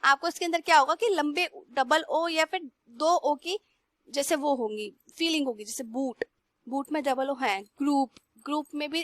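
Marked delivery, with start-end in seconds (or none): none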